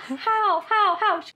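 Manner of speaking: high, loud retold shock; stunned disbelief. Retelling her reaction to news of triplets, stunned disbelief. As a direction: fire the word out high, imitating astonishment.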